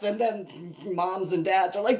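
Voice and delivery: ordinary talk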